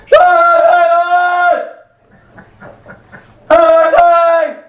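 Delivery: very high, slow, stretched-out shout; drawn-out yell. Acted. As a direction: holler for mercy, dragging out every word. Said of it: "I yelled".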